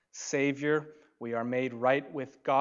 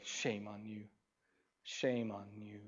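much softer, quiet "Shame on you"; hushed rebuke; 3 semitones lower, 10 dB quieter than usual. Acted. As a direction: barely say the scolding. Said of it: "come into your life and say".

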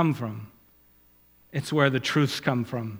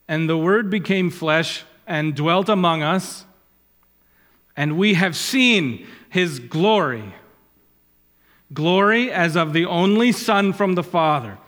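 raised, measured reading of scripture; 3 semitones higher, 3 dB louder than usual. Acted. Preacher quoting Jesus to Martha, solemn reading. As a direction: declaim the words of Jesus. Quotes John's gospel.

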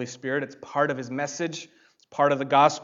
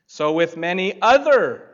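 "other" leaned on, louder and higher; stress on other; 4 semitones higher, 7 dB louder than usual. Shown as a demonstration. Pastor quoting Luke and stressing "other" for the congregation. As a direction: hit the word "other" hard. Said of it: "emphasize the word other".

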